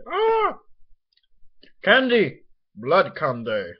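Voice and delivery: high, loud hammed-up vampire cry; vampire imitation. Playing a vampire thrilled by blood candy, hammed-up vampire imitation. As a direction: shout it like a candy-crazed vampire. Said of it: "Vampires are like".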